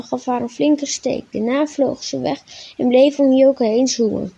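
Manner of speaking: usual speaking voice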